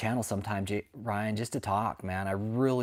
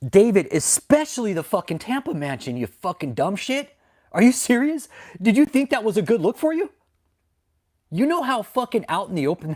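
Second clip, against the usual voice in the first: higher, louder angry yelling at David; furious rant; 8 semitones higher, 4 dB louder than usual. Real, not acted. Ranting streamer yelling at David over the Tampa mansion, furious. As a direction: let him have it, rising to a shout.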